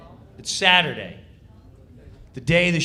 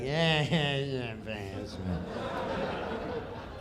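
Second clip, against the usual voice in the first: much softer, lower, laughing mumble; mock indifference; 5 semitones lower, 7 dB quieter, much slower than usual. Norm brushing off Kattan, soft laughing mumble. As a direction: shrug it off under your breath. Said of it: "just looking at him like".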